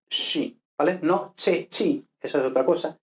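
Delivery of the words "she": "she" with a soft sh; sh sound. Shown as a demonstration. Teacher models the Italian sce sound. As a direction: say "she" with sh, not ch. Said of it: "representa she".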